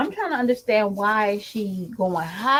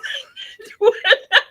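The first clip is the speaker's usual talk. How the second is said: high loud cackling laugh; loud cackle; 13 semitones higher, 6 dB louder than usual. Real, not acted. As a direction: cackle loudly. Laughing fit.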